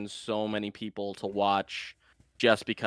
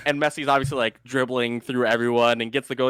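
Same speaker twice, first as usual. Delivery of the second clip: usual speaking voice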